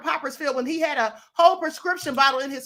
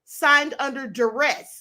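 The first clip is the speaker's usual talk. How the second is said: slow and deliberate; slower than usual. Shown as a demonstration. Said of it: "just put".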